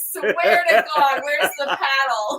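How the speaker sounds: high, loud laughing shout at Galen; yelling. Real, not acted. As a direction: yell it while laughing. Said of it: "yelling at Galen".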